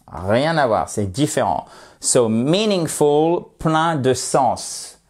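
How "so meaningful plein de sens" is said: slow model reading. Shown as a demonstration. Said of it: "teacher models slow pronunciation".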